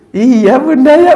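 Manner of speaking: put-on high, slow little child's voice, soothed; a small child calming down, slowly.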